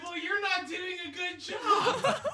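high, loud snarky comeback; roasting a cancer researcher, shouting; laughing mock yell. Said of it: "I'm like".

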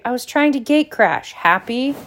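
high-pitched, exasperated retort; angry. A narrator's reading. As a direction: throw the confession back angrily. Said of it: "he said angrily".

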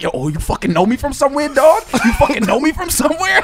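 high, heated challenge; mock turning up. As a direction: imitate someone getting worked up, pitch climbing. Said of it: "they'll start turning up".

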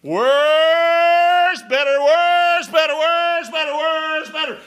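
loud, high, drawn-out preaching shout; preaching at full force. Real, not acted. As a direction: thunder it out, better, worse, better. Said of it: "I am not gonna sugarcoat it".

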